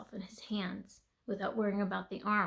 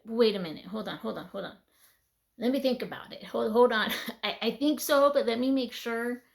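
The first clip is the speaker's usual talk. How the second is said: louder, rising hold on; flustered; 7 dB louder than usual. Retold or imitated. Stalling God in the dream, loud flustered. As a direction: backpedal in a comic rush. Retold panicked backpedal to God.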